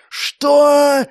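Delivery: sudden loud high yell. A narrator's reading. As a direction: bark it out in shock. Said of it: "заорал маг".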